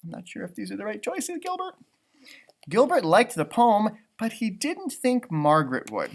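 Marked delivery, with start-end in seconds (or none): 0.0-1.7 soft aside. Real, not acted. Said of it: "reader's aside to the character".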